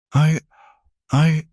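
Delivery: high, broken stammer. A narrator's reading. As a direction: stumble over the words. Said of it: "he stammered".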